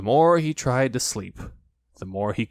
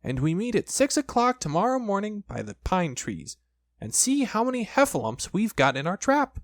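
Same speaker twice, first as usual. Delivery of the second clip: high, small piglet voice; 9 semitones higher than usual. Acted. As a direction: squeak it up like a tiny piglet. Piglet's voice in story.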